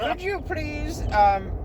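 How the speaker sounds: high, drawn-out demon plea; put-on high demon voice; cacodemon character voice. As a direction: plead slowly in a high creature voice. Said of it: "let me get the voice".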